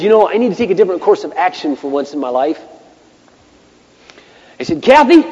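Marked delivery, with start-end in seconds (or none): 4.8-5.2 high, loud huffy call to his wife; retold huffy summons. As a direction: act out calling your wife in a huff. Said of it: "still kinda huffy".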